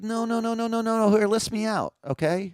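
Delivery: high, insistent voicing of Copernicus. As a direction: pitch way up and protest like Copernicus. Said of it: "But he's like".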